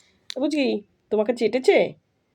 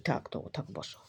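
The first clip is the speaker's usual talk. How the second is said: whisper; 6 semitones lower, 15 dB quieter than usual. Real, not acted. Hushing the dog gently.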